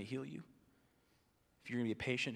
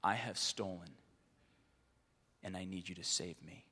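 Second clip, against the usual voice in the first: 1 humble confession; 4 semitones lower, 6 dB quieter than usual. Retold or imitated. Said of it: "people who come and repent and say".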